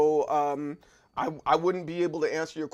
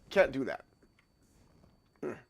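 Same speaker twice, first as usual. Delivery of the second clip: ordinary talk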